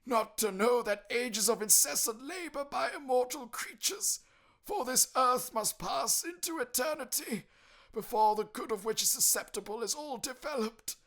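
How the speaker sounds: high, loud mournful spectre; anguished ghost lament, slowing at the end. Acted. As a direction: pour out regret like a damned soul. Ghost voice in story.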